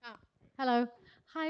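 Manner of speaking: ordinary delivery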